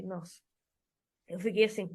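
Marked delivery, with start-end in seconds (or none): 0.0-0.4 breathless, much softer nossa; stunned gasp. Retold or imitated. Boyfriend calls out her pushiness, a stunned hushed nossa. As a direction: caught off guard, barely voiced. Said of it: "engoli uma salivinha".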